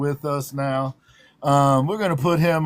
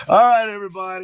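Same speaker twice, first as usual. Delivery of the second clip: ordinary delivery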